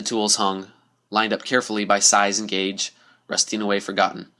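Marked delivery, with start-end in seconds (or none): none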